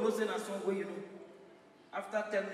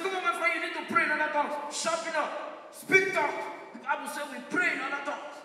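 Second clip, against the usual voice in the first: high, raised-voice scolding; scolding uncle; 7 semitones higher, 4 dB louder than usual. Acted. Uncle ordering nephew to pray in tongues, scolding. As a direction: lay into him, pitched high and insistent.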